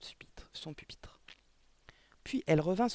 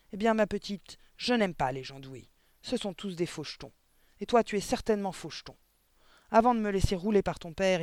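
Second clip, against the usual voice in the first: menacing headmistress; 3 semitones higher, 5 dB louder than usual. Acted. Headmistress warning the little girl, menacing. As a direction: threaten her, loud and hard.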